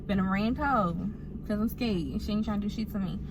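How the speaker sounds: ordinary talk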